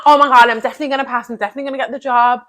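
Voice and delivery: hyped-up. Retold or imitated. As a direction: rattle it off like a pumped-up pep talk. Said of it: "Like, not".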